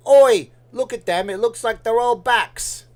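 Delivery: loud, high-pitched mock British shout; British accent. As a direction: holler in a put-on British accent. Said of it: "That's a British accent".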